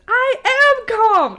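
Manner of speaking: high, loud, drawn-out mock scream; hammed-up shriek of protest, slowly stretched. [name] told to calm down by Brendan, mock scream. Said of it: "screams it".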